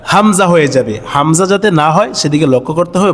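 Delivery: normal talking voice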